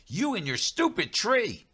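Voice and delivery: angry yell. Acted. Yelling at tree, jumping.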